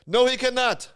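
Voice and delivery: high, loud Scottish refusal; Scottish accent. Retold or imitated. Man behind the counter refusing the kid, mock Scottish accent. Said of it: "he's, he's from Scotland".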